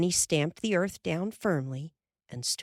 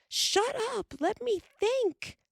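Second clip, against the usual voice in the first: high angry screech. A narrator's reading. Laura screeches at Carrie to let her think, angry screech. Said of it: "Laura screeched".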